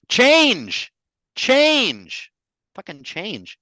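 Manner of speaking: high, loud laughing cry of change; frustrated yell. Retold or imitated. [name] mocking his own frustration at change with a loud laughing yell. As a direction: shout it out in mock exasperation.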